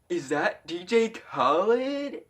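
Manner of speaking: high, drawn-out gawking question; mock amazement. Friend spots big dude at pool, high drawn-out amazement. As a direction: stretch it out high, imitating an astonished friend.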